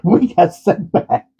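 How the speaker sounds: high, slowed by real laughter; laughing. Real, not acted. Vlogger admitting the yarn setback, laughing. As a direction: crack up on the punchline. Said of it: "Hopefully I don't laugh anymore".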